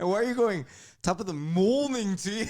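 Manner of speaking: high, mocking "morning"; exaggerated accent mimicry. Guest imitating co-host's attempt, over-accentuated "morning". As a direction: overdo "morning" in a high mock-Irish lilt. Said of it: "accentuate that?".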